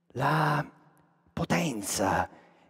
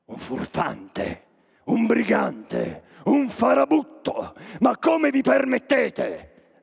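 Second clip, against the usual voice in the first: building outrage; 8 semitones higher, much slower than usual. Acted. Rising indignation at insults.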